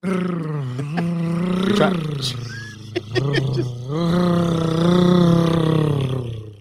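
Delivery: long trilled r held steady; rolled r. Shown as a demonstration. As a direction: roll the r out long and even. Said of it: "That was the rolling of the R's".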